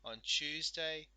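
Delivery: slow down and lift On Tuesday; stress on the day. Shown as a demonstration.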